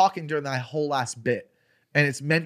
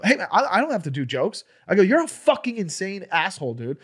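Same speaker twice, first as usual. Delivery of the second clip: higher, heated comeback, re-enacted; retold heated argument; 6 semitones higher, 4 dB louder than usual. Comedian going in on heckler, retold heat.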